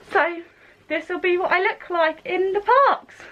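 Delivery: muffled behind a mask; through a face mask. Real, not acted. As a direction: speak through a cloth mask. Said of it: "guys can hear me with my mask on".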